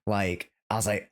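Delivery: normal talking voice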